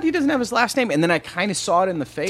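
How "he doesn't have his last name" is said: high-pitched disbelieving question; puzzled, pitch jumping up; retold surprise. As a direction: query it in high, mild disbelief. Retold surprise at nephew revelation.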